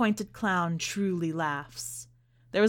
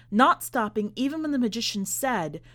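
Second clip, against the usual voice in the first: ordinary delivery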